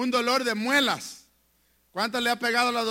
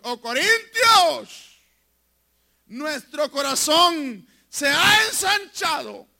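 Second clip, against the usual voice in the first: slow, loud, shouted proclamation of the verse; booming scripture reading; proclaimed scripture; 4 semitones higher, 6 dB louder, slower than usual. Acted. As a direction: cry the verse out to the church.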